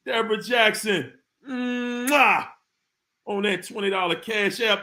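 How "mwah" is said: drawn-out "mmm-wah"; smacking kiss noise after a long hum; blown kiss. Real, not acted. Host thanking Deborah for cash, big blown kiss.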